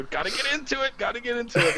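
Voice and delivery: hyped self-pep talk. Retold or imitated. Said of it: "you know".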